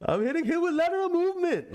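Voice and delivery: high-pitched mimicry of the trash talk; mock trash-talk voice, pitched high; imitated trash talker. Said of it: "They saying that shit with an accent".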